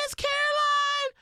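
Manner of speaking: put-on high-pitched kid calling to the teacher; naive child asking. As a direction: pipe up high like a clueless child. Retells childhood question to teacher.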